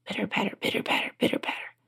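very soft, rhythmic whispering; whispered patter. A narrator's reading. Something creeps toward the royal table, whispered patter. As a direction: whisper the tiny footsteps. Voices small wet steps approaching.

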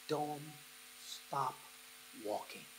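soft, spaced-out words; hushed, with a pause between words; quiet urging. Acted. Jesus speaking to one almost falling, quietly urging. Voices Jesus encouraging the faltering.

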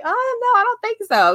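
high, squeaky brush-off of praise; embarrassed to be complimented, deflecting it in a high voice; bashful deflecting. Retold or imitated. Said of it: "I'm like".

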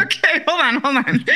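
laughing while cutting in; giggling hold on. Real, not acted. Host laughing interrupting.

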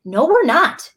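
emphatic denial. Retold or imitated. Retold objection to manager.

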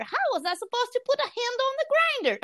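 high, laughing mock protest; mock innocent. Friend mimics the accused wife, mock innocent and high. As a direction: play dumb, giggling. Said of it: "she said, like".

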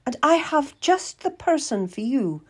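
high, assured noblewoman's voice; Lady Maria. Acted. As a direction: offer it in a high grande-dame voice. Lady Maria offers a servant.

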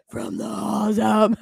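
slow hymn in a smoker's voice; mock drunken cigarette-voice singing; deep cigarette voice. Shown as a demonstration. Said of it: "my cigarette voice where I'm like".

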